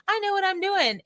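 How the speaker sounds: high, smug mock voice; mock know-it-all. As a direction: parody the overconfident, up high. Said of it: "so many people are just like".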